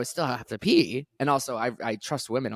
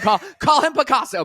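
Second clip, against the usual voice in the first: excited hype; 11 semitones higher, 7 dB louder than usual. Real, not acted. Hyping friend's painting.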